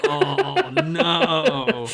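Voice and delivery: high, laughing "oh no". Real, not acted. Host reacting to "No, you don't", helpless with laughter. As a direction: groan it through real laughter.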